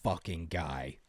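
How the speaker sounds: low, soft, slow grumble; muttered under breath. Acted. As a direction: mutter it as you turn away. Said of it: "they'll mutter under their breath".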